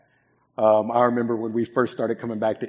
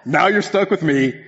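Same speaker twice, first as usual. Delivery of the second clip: louder and slower, teasing the room; loud slow joke; 7 semitones higher, 8 dB louder than usual. Real, not acted. Joke punchline to congregation.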